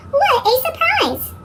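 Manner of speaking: high, delighted cartoon squeal; squeaky cartoon voice. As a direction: burst out with glee. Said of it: "cries Pete".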